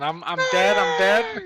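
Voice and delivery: high, loud gamer cry; hammed-up panicked shout; mock yelling. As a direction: yell it like you just died in a shooter. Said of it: "I'm over here yelling".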